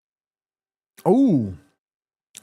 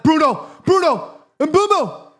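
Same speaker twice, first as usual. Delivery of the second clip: high rising calls of names; excited calling; 9 semitones higher than usual. Real, not acted. Calling players during attack.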